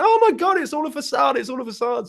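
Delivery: imitating an excited shout that fades; excited outburst. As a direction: mimic a guy leaping around, freaking out. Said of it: "started leaping around going".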